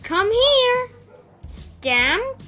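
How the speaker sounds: high, loud angry call. A narrator's reading. Angry Aunt Sarah calls Stamp back, high and loud. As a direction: call the dog back sharply. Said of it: "she cried".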